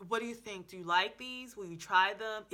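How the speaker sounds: normal talking voice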